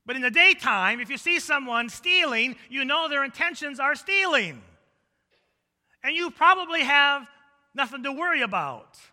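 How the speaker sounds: loud, high sermon delivery; forceful preaching. Real, not acted. Preacher explaining nighttime and daytime thieves to congregation, forceful preaching. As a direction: preach it out at full volume.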